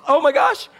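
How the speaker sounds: high, loud "Oh my gosh" in shock; startled gasp. Retold or imitated. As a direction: react as if a ghost appeared. Frightened disciples react.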